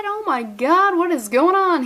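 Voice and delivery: over-the-top amazement; astonished. Pretend. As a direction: react as if totally bewildered. Astonished reaction to story twist.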